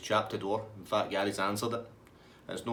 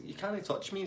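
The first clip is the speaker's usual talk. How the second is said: high mock taunt; cheeky; 10 semitones higher, 4 dB quieter than usual. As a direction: imitate a chancer goading the police, up high. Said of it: "you need to stop being cheeky".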